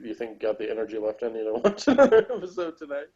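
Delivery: normal talking voice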